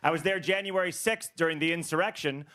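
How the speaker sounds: usual speaking voice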